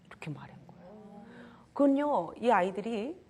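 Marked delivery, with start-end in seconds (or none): none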